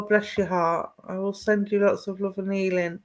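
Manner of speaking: normal talking voice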